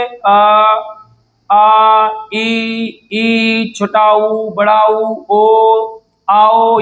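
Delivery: each vowel set apart by pauses; reciting the vowels. Shown as a demonstration. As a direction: count off the Hindi vowels one at a time. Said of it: "Hindi में स्वर कितने होते हैं".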